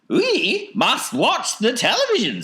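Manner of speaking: high eccentric falsetto; urgent Wonka. A narrator's reading. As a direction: instruct them in a piping voice. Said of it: "said Mister Wonka".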